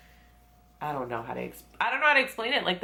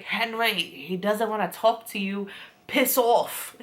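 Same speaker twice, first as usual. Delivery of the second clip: raised, forceful mock dismissal; acted telling-off; 4 semitones higher than usual. [name] telling Henry to leave Nick alone, mock angry.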